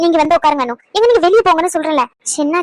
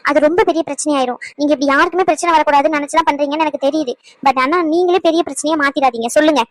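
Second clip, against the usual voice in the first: ordinary delivery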